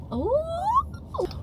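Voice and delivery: sharp high gasp; startled gasp and high oh; startled gasp in the car. Real, not acted. Surprise in car.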